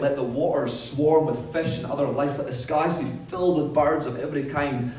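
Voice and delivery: big, loud voice of God; booming biblical narration. Acted. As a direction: proclaim it like scripture from the pulpit. Reading God's words aloud.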